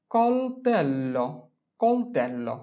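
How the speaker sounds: slow model pronunciation. Shown as a demonstration. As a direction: stretch কলতেল্লো out for the class. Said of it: "teacher models pronunciation".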